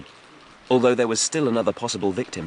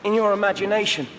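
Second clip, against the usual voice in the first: put-on much higher young man's voice; defiant youth; 12 semitones higher, faster than usual. A narrator's reading. Wim taunts the Grunton thugs, high and defiant. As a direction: toss it back, cheeky.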